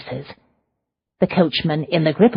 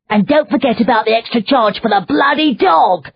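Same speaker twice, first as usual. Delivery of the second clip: much higher, louder coachman's indignant shout; 8 semitones higher, 6 dB louder than usual. A narrator's reading. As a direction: shout after them about the dog fee. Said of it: "the coachman shouted".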